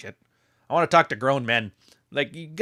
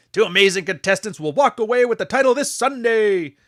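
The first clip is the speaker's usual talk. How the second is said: higher, louder hyped-up ring announcer; wrestling announcer; 9 semitones higher, 4 dB louder than usual. Retold or imitated. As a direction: sell the big Sunday match. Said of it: "your nineteen ninety-three W W F voice".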